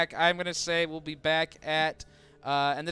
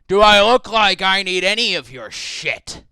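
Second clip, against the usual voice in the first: higher and louder, a furious contemptuous snap; angry outburst; 6 semitones higher, 8 dB louder than usual. Acted. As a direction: throw the insult back in his face, loud. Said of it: "he just looks at you and goes".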